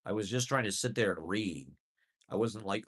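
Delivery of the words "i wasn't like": usual speaking voice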